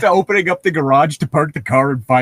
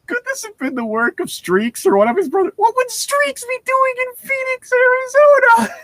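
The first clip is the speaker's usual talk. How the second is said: mock panic. A narrator's reading. Reading Bella's racing thoughts.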